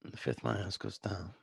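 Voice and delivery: soft, understated tough-guy impression; Bruce Willis. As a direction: say it quietly, barely projecting. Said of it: "This is my Bruce Willis from Pulp Fiction".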